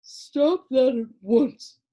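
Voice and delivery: slow, loud, drawn-out command; a plaid creature scolding Milo for laughing; ordering creature. A narrator's reading. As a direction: order him to stop, sleepily stern. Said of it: "ordered the plaid one".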